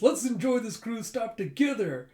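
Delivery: excited cartoon cheer; gleeful exclamation. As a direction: call it out while skipping off happily. Skipping away, gleeful character.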